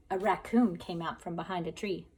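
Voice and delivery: ordinary delivery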